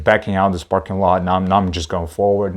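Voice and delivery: ordinary talk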